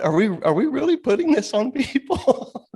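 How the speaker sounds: high, laughing question; squeaky laughing incredulity; laughing disbelief. Real, not acted. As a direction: crack up while asking.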